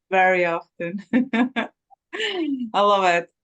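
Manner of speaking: ordinary delivery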